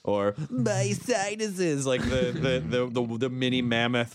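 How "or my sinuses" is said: slow put-on creature voice; cartoon animal voice. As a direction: drawl it as a cartoon beast.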